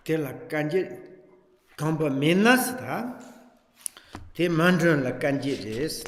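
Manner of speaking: reciting scripture for students; liturgical recitation. Acted. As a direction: intone each phrase with pauses between. Recites Tibetan liturgical verse.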